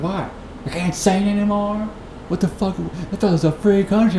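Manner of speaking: high-pitched mock protest; indignant street character. Street singer protesting to people staring, high indignant voice. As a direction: pitch it way up, acting affronted.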